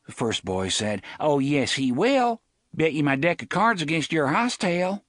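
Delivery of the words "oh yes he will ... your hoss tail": cocky bet. Acted. Bully makes a bet.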